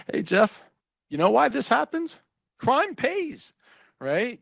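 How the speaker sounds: high-pitched, amused needling; imitated amused colleague. Colleague ribbing Jeff in his office, imitated amusement. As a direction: tease it out high, as if after a laugh. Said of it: "he would laugh and he'd sit in my office".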